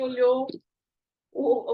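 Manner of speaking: normal talking voice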